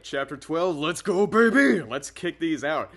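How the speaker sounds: loud, high, drawn-out rallying cry; hyped-up. Real, not acted. Reader kicking off chapter twelve, hyped-up and loud. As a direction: pump up the crowd, stretching the words.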